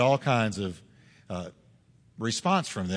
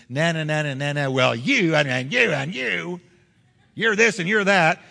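mocking playground taunt; 2 semitones higher, 4 dB louder than usual. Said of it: "reminding me of third graders on a playground".

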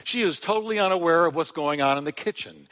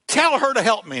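angry Martha; 5 semitones higher, 4 dB louder than usual. Acted. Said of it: "she is mad".

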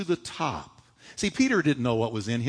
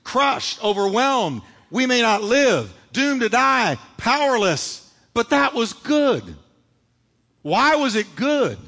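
higher, louder, every word hit; preaching at full force; 6 semitones higher, 5 dB louder, slower than usual. Real, not acted. Preacher repeating the list, crying it out to the church. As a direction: shout the list to the back row. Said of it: "Can I say it again".

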